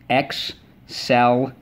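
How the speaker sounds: "Excel-lent" split slowly into Ex-cel-lent; syllable by syllable. Shown as a demonstration. Teacher to class, tapping syllables slowly on fingers. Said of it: "look what we do. Take your fingers".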